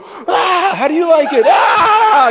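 high strained cry bursting into a shout; frustrated outburst. Acted. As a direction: let the frustration explode in a loud crying yell. Pent-up rage bursting out.

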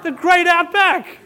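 high, loud gushing announcement with a laugh; mock excited shout. Lady in the shoe line tells everyone about missionaries, loud excited shout. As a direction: play an overexcited shopper telling the whole line. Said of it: "starts shouting it to the other people".

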